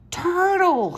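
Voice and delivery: very high, loud cry of the word; child shouting at a giant turtle; excited shout. A narrator's reading. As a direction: burst out at the sight of it. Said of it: "shouted Buddy".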